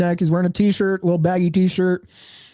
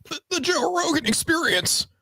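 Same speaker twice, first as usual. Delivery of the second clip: RFK impression; 9 semitones higher than usual. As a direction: croak it out high and halting. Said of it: "If it were R F K, it'd be like".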